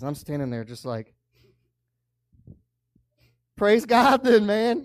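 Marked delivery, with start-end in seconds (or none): 3.6-4.8 loud, high burst of thanks to God; joyful exclamation of relief; loud praise. Retold or imitated. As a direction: throw it up like a shout of praise. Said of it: "you know, pray like that".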